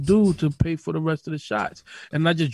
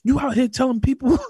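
scolding mother; 9 semitones higher than usual. Retold or imitated. Mother scolds son about rabies lie, high mock anger. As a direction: tear into him like an angry mom.

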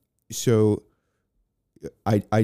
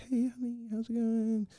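much higher, softer motherly coo; imitating a sweet mom voice; motherly greeting; 6 dB quieter than usual. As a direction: greet him like a doting mom. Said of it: "And she's like a mom".

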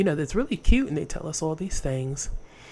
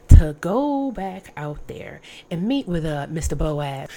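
scheming mother-in-law; 3 semitones higher, 7 dB louder than usual. Acted. Naomi instructing Ruth.

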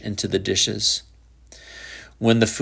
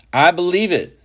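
put-on defiant boy; 9 semitones higher, 4 dB louder than usual. A narrator's reading.

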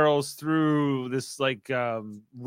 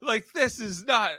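mock yell; 7 semitones higher, 4 dB louder than usual. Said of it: "And he's like".

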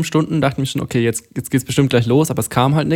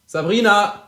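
calling out; 5 semitones higher, 5 dB louder than usual. Real, not acted. Calling out to a colleague.

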